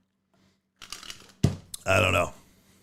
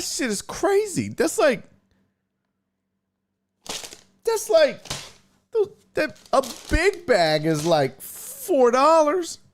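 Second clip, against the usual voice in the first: high, incredulous imitation of his girlfriend; girlfriend's voice; 11 semitones higher, slower than usual. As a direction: squeak it out like she can't believe the price, slowing on the last words. Girlfriend quoted, shocked at price.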